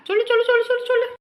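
very high, repeated goading; teasing urging. Acted. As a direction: egg your friend on in a high voice. Goading friend to lie to wife.

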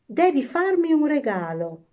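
put-on high, loud childish demand; calling out. A narrator's reading. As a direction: cry it out eagerly. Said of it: "cominciò a gridare".

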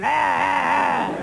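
shouted goofy nonsense yelps; Jerry Lewis. Retold or imitated. Old comedian doing a Jerry Lewis bit, shouted goofy yelps. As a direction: yelp it like a hammed-up old comic. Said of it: "age like Jerry Lewis".

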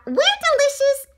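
high, loud proud shout; shouted back. A narrator's reading. Donuts answer the baker, shouting proudly. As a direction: call it out in a high squeal. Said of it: "the donuts shouted back".

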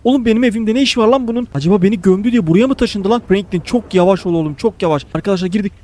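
normal talking voice

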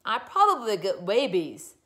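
loud, stuffed-up crybaby whine; snuffling.

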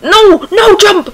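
high, loud cry of protest; frustrated yell. Real, not acted. Gamer to character missing a jump, frustrated yell. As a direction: yell it in sudden panic.

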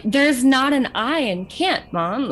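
exasperated correcting. Retold or imitated. Kids correcting mom's accent, mock exasperated. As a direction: spell it out slowly for a parent.

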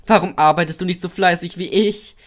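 high, loud teasing question; cheeky taunt. A narrator's reading. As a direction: needle a sleeper at full pitch. Cheeky engine taunts sleeping engine.

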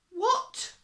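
high, loud outraged cry; furious. A narrator's reading. Fire chief hearing the excuse, crying out furiously. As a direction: burst out in storybook fury. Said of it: "cried the fire chief furiously".

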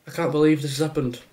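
ordinary talk, nothing marked

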